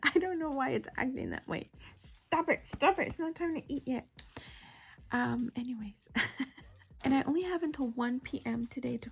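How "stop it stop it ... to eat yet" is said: higher, playful telling-off; pitched-up mock reprimand; mock scolding. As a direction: scold your own stomach in play. Playfully scolds her rumbling stomach.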